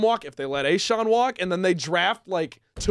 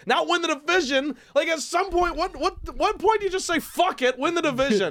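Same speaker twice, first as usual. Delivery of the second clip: exasperated; 8 semitones higher than usual. Real, not acted. Exasperated rant.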